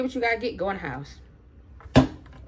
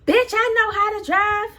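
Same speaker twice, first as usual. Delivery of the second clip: high, indignant imitated comeback; acted-out angry outburst, pitched way up; angry retort; 10 semitones higher, 4 dB louder than usual. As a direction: mimic an irate driver snapping back. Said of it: "are you angry".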